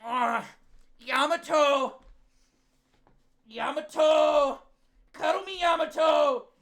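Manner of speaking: high, loud, stretched mock wail; hammed-up longing cry. Streamer mocking Yuki begging Yamato for a cuddle, hammed-up wail. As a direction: cry out a name in mock yearning. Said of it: "he's like, he's like".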